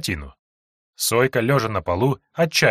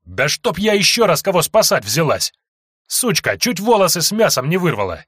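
angry; 6 semitones higher, 4 dB louder than usual. Acted. Sojka fights off attacker.

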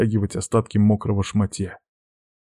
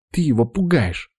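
higher-pitched, hushing scold; shushing reproach; 5 semitones higher than usual. A narrator's reading. Witch shushing the man for scaring the cat, reproachful. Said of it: "шикнула на меня ведьма".

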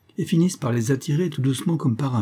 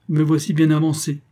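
scoffing, pitched up high; sneering officer; 5 semitones higher, 3 dB louder than usual. Acted. Officer mocking the prince before the crowd, sneering. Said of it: "fit le militaire en ricanant".

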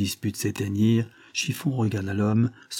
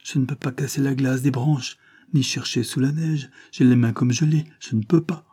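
put-on higher-pitched, helpless child's voice; lightly voiced freezing child, protesting; pleading child; 4 semitones higher, 3 dB louder than usual. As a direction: plead that you really can't. Cold child pleads with angry man.

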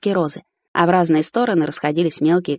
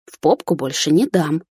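plain reading voice

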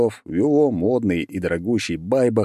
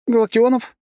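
very high, louder squeaked question; frightened squeak; 5 dB louder than usual. A narrator's reading. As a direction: squeal the name like a terrified man. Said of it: "пискнул кто-то сбоку". (писклявым испуганным голосом)

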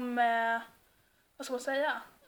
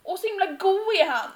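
higher, slow, drawn-out Gothenburg lilt; extra-thick Gothenburg accent; 5 semitones higher than usual. Shown as a demonstration. Praising someone who was funny, in put-on Gothenburg accent. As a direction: lay the Gothenburg dialect on thick for a joke. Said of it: "lägger man sig alltid på med extra göteborgska".

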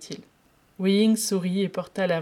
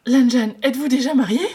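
high, loud, alarmed rising ask; panicked question; 6 semitones higher, 12 dB louder than usual. Acted. Wei Ying fears Lan Zhan is married, loud and panicked. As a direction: blurt it out in fear.

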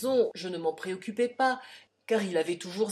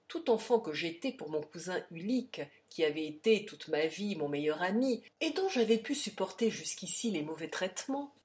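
ordinary delivery

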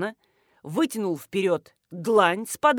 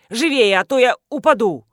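loud, urgent bark; barked order; 2 semitones higher, 9 dB louder than usual. A narrator's reading. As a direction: bark at someone to hurry up. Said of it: "рявкнул Александр Михайлович". (нетерпеливо рявкнуть)

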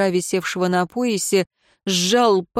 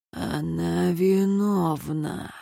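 eerie, stretched-out hushed verdict; hushed accusation; 6 dB quieter, much slower than usual. A narrator's reading. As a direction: let it seep out slowly and softly. Eerie words from another's lips.

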